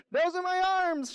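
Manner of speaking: extremely high-pitched mock shout; shouting tree. A narrator's reading. Tree protests to beaver about its arms, very high shout. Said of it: "shouts the tree".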